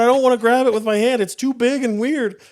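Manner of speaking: high, loud flustered voice; mock panic. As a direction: fret over the mess, high and loud. Panicked retold inner voice.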